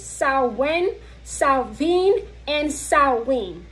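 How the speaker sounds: "Samhain" said SOW-win, then SOW-vin, then SOW-win again; "Samhain" run through its variants, the middle one with a v; three pronunciations. Shown as a demonstration. Listing the three accepted ways to say "Samhain" for viewers. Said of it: "Three pronunciations".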